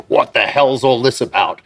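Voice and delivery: indignant boss. Retold or imitated. Boss confronts his assistant over working at another studio, indignant. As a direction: act out a boss demanding an explanation.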